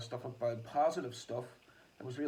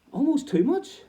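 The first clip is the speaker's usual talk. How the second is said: much higher and louder, rising on much; high exclamation; 14 dB louder than usual. Real, not acted. Musing on music lineage to the audience, exclaiming high and loud, rising at the end. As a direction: cry it out, amazed at how much there is.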